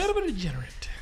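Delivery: whispered repeat of the quip; hushed aside. Acted. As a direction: say it again under your breath.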